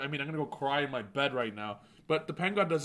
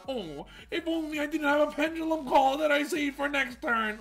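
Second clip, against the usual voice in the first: high, sing-song fake sorrow; teasing opponent with fake regret; mock regret; 11 semitones higher, 3 dB louder than usual. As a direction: pretend to lament, tongue in cheek. Mock regret before combo.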